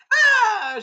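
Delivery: very high, loud mock shriek; hammed-up shriek while falling; zapped scream. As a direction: shriek as if shocked. Acts princess screaming.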